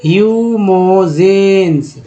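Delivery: ह्यूमोजेंस drawn out slowly with extra force; slow read-along. Shown as a demonstration. Teacher reads ह्यूमोजेंस slowly for students to repeat. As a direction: sound out ह्यूमोजेंस slowly and clearly. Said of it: "वो हमारे साथ पढ़ें".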